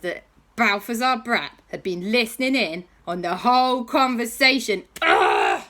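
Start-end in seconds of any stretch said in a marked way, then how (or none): 5.0-5.6 deep, loud fed-up ugh; disgusted groan. Acted. J B groaning loudly at the kids' silly mistake. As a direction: groan at their silliness. J B frustrated.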